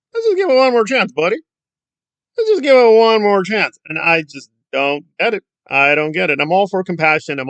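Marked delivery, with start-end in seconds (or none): 0.1-1.4 high, quick silly voice; mock pleading. Commentator mimicking those wanting one more chance, mock pleading. Mocking lenient supporters in silly voice. 2.4-3.8 higher, louder mocking plea; mock pleading. Commentator mimicking supporters of another chance, loud mock pleading.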